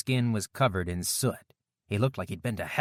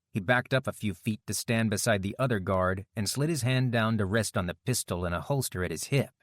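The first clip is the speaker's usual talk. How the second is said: plain reading voice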